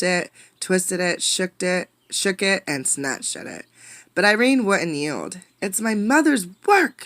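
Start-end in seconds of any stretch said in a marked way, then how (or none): 5.6-7.0 somewhat higher, louder cry of protest; mild mock scream. Irene shouts at the wind over the box, a mild scream. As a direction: hold on and yell it back. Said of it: "she screamed".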